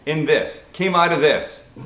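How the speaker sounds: ordinary delivery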